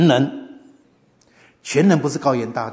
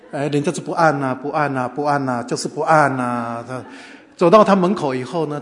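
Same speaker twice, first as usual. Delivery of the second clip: ordinary delivery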